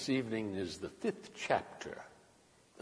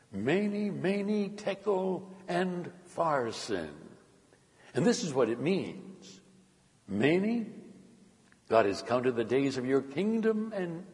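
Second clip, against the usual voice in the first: emphatic pronouncement, each foreign word pitched high; Daniel, solemn prophet; 5 semitones higher, 4 dB louder than usual. A narrator's reading. Daniel interprets the writing for the king, emphatic prophetic pronouncement. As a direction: pronounce judgment, ringing out each inscribed word.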